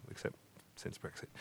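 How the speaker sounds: whispered wry aside; whispering. Real, not acted. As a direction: drop to a whisper for the punchline. Wry aside about London.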